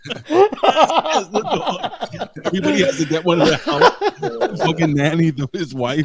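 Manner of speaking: high, loud words through helpless laughter; real laughing fit. Real, not acted. Co-host piling onto the tattoo joke, laughing hard. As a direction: squeal it out while cracking up.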